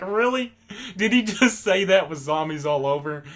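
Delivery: high, laughing "Really?"; tickled, can't believe he said it; laughing disbelief. Real, not acted. Amused disbelief at Eugene's line.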